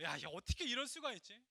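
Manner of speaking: loud, high acted indignation; retold outrage. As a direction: act out fuming disbelief at full voice. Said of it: "너무 화가 나는 거야". (분통 터뜨리는 재연)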